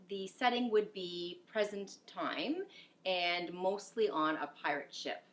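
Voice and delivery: ordinary delivery, nothing marked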